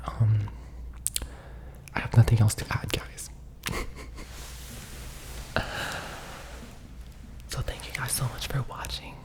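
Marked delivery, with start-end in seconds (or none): 3.3-7.4 long whispered laugh. Real, not acted. Embarrassed wrap-up.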